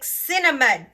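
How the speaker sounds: scolding the dog Cinnamon as he chews a card, loud call; yelling at the dog. Real, not acted. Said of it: "I'm, like, yelling at my dog".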